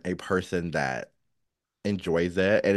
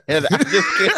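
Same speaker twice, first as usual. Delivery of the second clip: laughing; 12 semitones higher, 10 dB louder than usual. Real, not acted.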